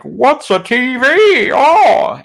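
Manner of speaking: high, loud, drawn-out cartoonish bafflement; mock-astonished yelp, stretched out and overblown; baffled biblical person. Streamer mocking the storyteller's running TV joke, hammed-up bafflement. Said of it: "the biblical person is like".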